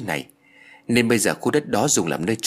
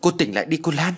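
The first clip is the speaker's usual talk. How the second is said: raised, higher-pitched urgent call; calling out loudly; 7 semitones higher than usual. A narrator's reading. Man calling to a fainting woman, calling out loudly. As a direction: call out to rouse her. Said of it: "Anh gọi lớn".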